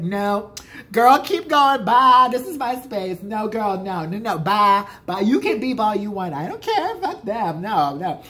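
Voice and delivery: loud, high imitated warding-off; yelling. Drunk friend guarding a parking space, yelling at drivers. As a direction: shoo drivers away at full voice, sassy and insistent. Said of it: "yelling at other people".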